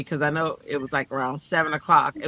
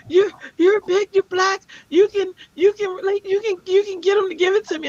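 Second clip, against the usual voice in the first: high, loud frantic pleading, imitated; hammed-up panicked girl begging for help; imitating hysterical neighbour; 4 dB louder than usual. Imitating the hysterical girl begging her to go down there, high and frantic. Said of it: "She was hysterical".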